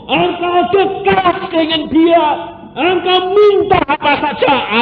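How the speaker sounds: high, loud preacher's cry of thanks; fiery preaching. Acted. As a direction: preach it out at the top of the voice. Preaching at full force. (khotbah berapi-api dengan suara tinggi dan keras)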